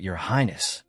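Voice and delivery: a mildly fearful, small appeal; timid. A narrator's reading. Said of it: "timidly calling out".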